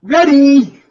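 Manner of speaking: ready, high and loud, gushing like a giddy pilot. Acted. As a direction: squeal it out all excited. Campy pilot answers tower.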